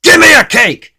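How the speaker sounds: very loud, high rude order; snapped. Acted. Mister Grumpy snaps his order at Missus Fairy, shouting. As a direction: bark it at the baker. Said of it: "snapped Mister Grumpy".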